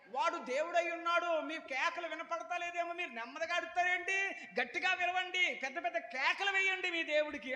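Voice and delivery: high mocking voice egging them on; mock the prophets, urging them to shout; sarcastic taunt. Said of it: "అంటున్నాడు ఏలియా". (ఏలియా ప్రవక్తలను ఎగతాళి చేస్తూ)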